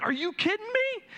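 as if he can't believe it, voice jumps high; disbelief. Retold or imitated. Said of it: "I'm like".

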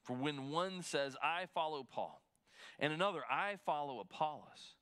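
measured, solemn Bible reading; scripture reading. Acted. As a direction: read the verses with weight. Pastor reads scripture aloud.

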